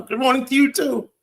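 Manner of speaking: high-pitched cheery greeting; mock-cheerful, pitched well up. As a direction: chirp it with exaggerated good cheer. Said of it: "So I cheerfully wrote back".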